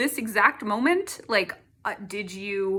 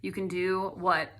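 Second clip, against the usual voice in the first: ordinary talk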